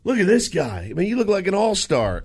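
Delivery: put-on high, cheery praise for a kid; lightly voiced friendly compliment; hearty praise. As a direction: hype up a little fan. [name] praising the son.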